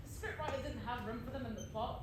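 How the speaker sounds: ordinary talk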